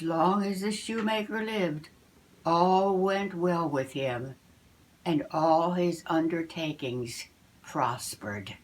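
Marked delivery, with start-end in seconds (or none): none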